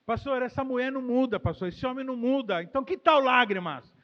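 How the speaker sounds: loud, insistent complaint to the pastor; complaining member. Pretend. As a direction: hammer the complaint home, then offer tears. Voicing complaining church member. (fiel reclamando alto ao pastor)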